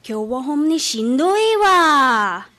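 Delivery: slow, weary Osaka-dialect sigh of a line; Osaka dialect complaint. Retold or imitated. Host family saying they're worn out, in slow Osaka dialect. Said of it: "と言っていました".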